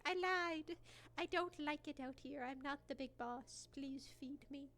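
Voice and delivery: voicing the cat. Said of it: "'cause he was like".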